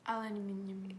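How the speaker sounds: "Aluminium" as al-yoo-MIN-ee-um; British aluminium. Shown as a demonstration. Friend supplying the British way, "Aluminium". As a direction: give the British five-syllable "Aluminium". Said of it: "how British pronounce some words".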